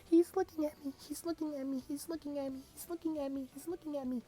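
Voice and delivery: tiny, very high panicked squeal; flustered girl squeal. Acted. Blushing girl repeating it in her head, squealing fluster.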